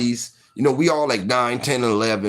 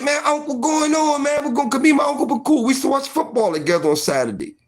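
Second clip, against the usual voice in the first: scared pleading; 11 semitones higher, faster than usual. Retold or imitated. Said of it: "So I'm like".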